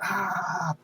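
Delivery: high, soft imitation scream; mock scream. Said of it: "sitting there screaming".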